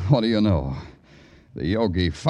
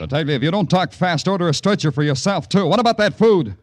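louder, pressing demand; impatient; 8 semitones higher, 6 dB louder than usual. Acted. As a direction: push him to hurry up. Impatient detective on phone.

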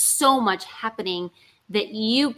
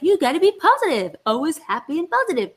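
mock cheerful; 6 semitones higher than usual. Mocking her old belief.

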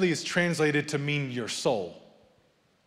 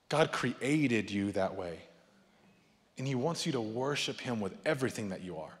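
tender reassurance; 5 semitones lower, 7 dB quieter than usual. Acted. Pastor gently reassuring the congregation that emotions are okay.

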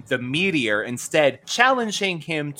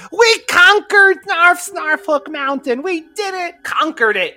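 high, slow squeaky cheer; Snarf voice; 3 dB louder than usual. Retold or imitated. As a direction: squeal the victory like a tiny furry sidekick. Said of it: "where Snarf is like".

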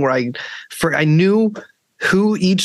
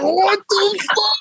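much higher, slow, drawn-out mock shock; shocked disbelief; slower than usual. Tripping guy reacting to his zoned-out friend, high disbelief. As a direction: stretch it out like you can't believe your eyes. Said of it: "I was like".

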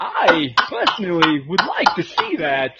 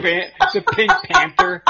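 laughing; 13 semitones higher, 5 dB louder than usual. Real, not acted. Laughing while naming a theme.

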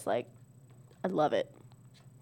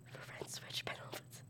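barely audible whisper to oneself; whispered rehearsal; 23 dB quieter than usual. Real, not acted. Player told to go faster, whispering the card to herself. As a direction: whisper it quickly under your breath. Repeating the card under breath.

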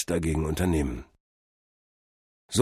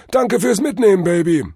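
very high, loud guru warning; jive-talking drug-vision god; 13 semitones higher, 9 dB louder than usual. A narrator's reading. God advises Cordell against overthinking, high loud guru. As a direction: warn him like a trippy guru. Figure of Tod Hermes.

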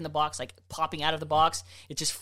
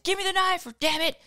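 frantic stepdad; 13 semitones higher than usual. Retold or imitated. Stepdad freaking out.

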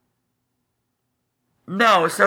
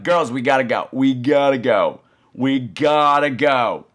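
urging; 5 semitones lower, slower than usual. Retold or imitated. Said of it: "I'm like".

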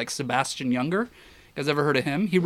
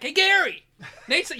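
imitating a call across; 14 semitones higher, 3 dB louder than usual. Photographer calling out to Gary across the compound, mock-loud and high. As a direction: hail a friend across the yard, hamming it up.

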